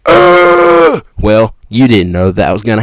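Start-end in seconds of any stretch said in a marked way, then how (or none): 0.1-1.0 very high, loud comic squeal; mock shriek. Bitten victim reacting to cave creature, high mock shriek. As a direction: shriek in exaggerated alarm.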